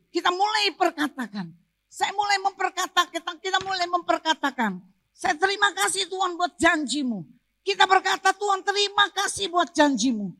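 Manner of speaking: high-pitched, driving sermon delivery; forceful preaching. Real, not acted. Preacher urges congregation to keep declaring God's promise, forceful preaching. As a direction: preach with insistent, rising force.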